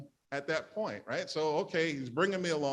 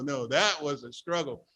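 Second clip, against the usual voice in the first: ordinary delivery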